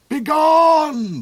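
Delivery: high, loud furious scream. A narrator's reading. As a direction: blast them out of the room. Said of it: "the cold Duke screamed".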